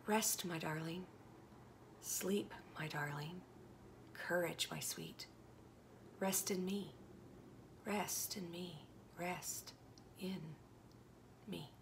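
soft, hushed, rocking comfort; tender murmur fading to silence; lullaby softness. Acted. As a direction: sing her to sleep with words. God's lullaby to her.

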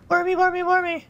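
very high, loud taunting call; high singsong call. Real, not acted. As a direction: sing out to it, teasing and shrill. Calling out to a worm.

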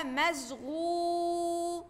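مشغول with the oo held long and slow; drawn-out long vowel. Shown as a demonstration. Teacher stretching the vowel for the class, slow. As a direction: draw out the oo in مشغول. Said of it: "تعالى للكلمة اللي بعدها".

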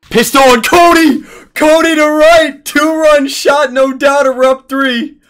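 high, loud home-run call; excited play-by-play. Real, not acted. Gamer calling a two-run shot, excited shouting. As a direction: shout the call, stretching words in triumph.

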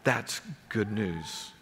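ordinary talk, nothing marked

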